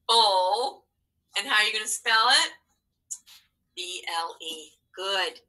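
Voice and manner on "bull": usual speaking voice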